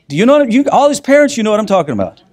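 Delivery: high, loud plea to the parents listening; emphatic appeal. Real, not acted. Speaker appealing to the parents in the audience, high and loud. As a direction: call out to them, all in.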